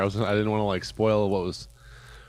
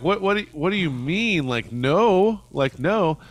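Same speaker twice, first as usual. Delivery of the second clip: alarmed clerk; 7 semitones higher, 5 dB louder, slower than usual. Retold or imitated. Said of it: "And they're like".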